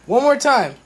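loud eager cry; hyped shout. Real, not acted. Teen shouts for another flame, hyped. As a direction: demand it again, fired up.

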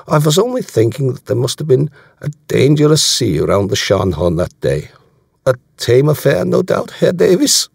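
put-on German accent, rising in pitch to the question; German commander, probing. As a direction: needle him lightly in a German accent. Voicing German commander in dialogue.

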